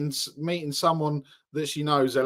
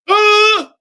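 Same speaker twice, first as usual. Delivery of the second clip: loud high startled cry; panicked yelp; 13 dB louder than usual. Retold or imitated. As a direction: squeal and bolt. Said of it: "And Jim's like".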